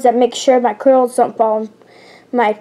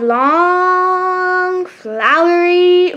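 "long" and "flowery" stretched out slow and high; drawn-out emphasis; 5 semitones higher, much slower than usual. Acted. Reader describing the dancers' skirts, "long, flowery" drawn out. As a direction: hold "long" and "flowery" as if showing their length.

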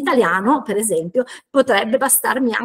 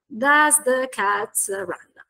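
stretched, measured "Does the cat run"; slow model sentence; much slower than usual. Shown as a demonstration. Teacher composing an example question, slow and deliberate. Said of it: "Per esempio".

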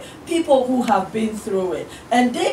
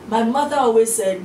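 ordinary delivery

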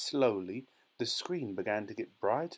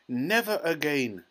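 loud, raised refusal; angry shout; 5 semitones higher, 5 dB louder than usual. A narrator's reading. Father forbids his son television, angry shout. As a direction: shout it firmly. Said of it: "shouted Mister T V".

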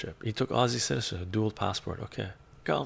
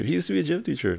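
mock voice; 8 semitones higher, 3 dB louder, much faster than usual. Repeats line in put-on voice.

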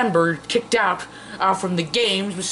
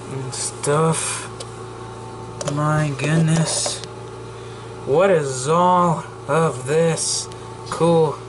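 slow put-on character voice; entranced toy figure; 3 semitones lower, much slower than usual. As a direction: draw out each word in mock wonder. Toy figure marvels at the phone.

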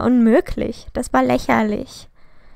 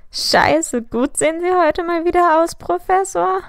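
mimicking the slip much higher, rising to a squeak; mocking imitation; 6 semitones higher, 3 dB louder than usual. A narrator's reading. Sirius mimics James's line to the professor, mocking. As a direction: parrot his words back to tease him. Friend mocks James's blunder.

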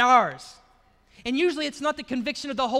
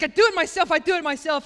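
imitated stubborn child, higher and louder; mock little-kid tantrum, pitched up; kleines Kind mit Trotz; 5 semitones higher, 6 dB louder than usual. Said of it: "because she had a temper".

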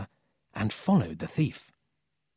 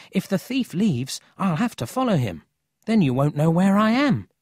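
higher voice, building louder at the end; lightly voiced concerned boy; 7 semitones higher, 4 dB louder than usual. A narrator's reading. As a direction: explain the risk earnestly. Emil explains his concern.

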